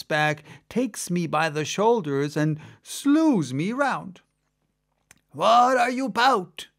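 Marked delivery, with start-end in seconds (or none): 5.3-6.7 gruff merman challenge. Acted. Merman suddenly accosts narrator.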